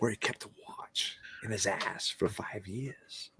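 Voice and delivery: hushed Walken-style whisper; whispered Christopher Walken. Pretend. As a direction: breathe it like a menacing Walken. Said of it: "the mic doesn't pick up the whisper".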